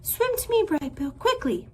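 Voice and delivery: high urgent call across the water; high, loud urgent call to the gosling. Acted. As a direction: call him in fast. Mother goose calls to gosling.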